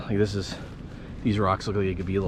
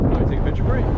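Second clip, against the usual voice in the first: calling out; 11 semitones higher, faster than usual. Real, not acted. Calling out over wind.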